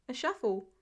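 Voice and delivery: ordinary delivery, nothing marked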